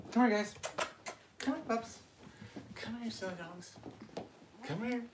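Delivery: high, bright calling to the pups. Real, not acted. Owner calls her puppies to come in a high voice. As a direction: call them over in a high voice.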